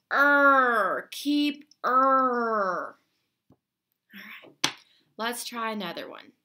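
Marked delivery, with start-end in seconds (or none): 0.1-3.0 "Er, keep er" with the tongue pulled far back; swallowed dark vowels. Shown as a demonstration. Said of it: "pull your tongue back in your mouth as far as you can and go".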